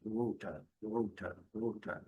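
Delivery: water with no R at the end; silent R. Shown as a demonstration. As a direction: say water, dropping the final R. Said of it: "Letra R não é pronunciada".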